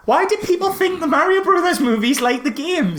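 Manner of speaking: high, loud incredulous ranting; worked-up film rant; exasperated rant. Real, not acted. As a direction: vent your disbelief. Ranting about the Mario movie.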